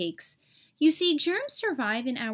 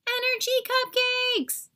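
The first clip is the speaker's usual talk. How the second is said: high, thrilled cry of delight; gleeful cheer; 11 semitones higher than usual. Acted. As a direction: squeal it like a treat. Germs cheering about cupcakes.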